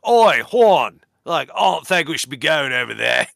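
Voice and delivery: a loud working-class English Chewie; mock Cockney Chewbacca line. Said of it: "he kinda has a lower class English accent".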